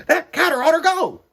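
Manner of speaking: high, loud put-on character voice; high strange voice. Family member complaining about the cat, high put-on voice.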